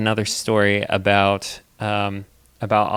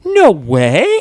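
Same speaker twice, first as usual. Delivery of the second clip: put-on loud, high little-kid voice; loud high kid disbelief; toddler no way; 6 semitones higher, 10 dB louder than usual. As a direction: imitate an amazed toddler.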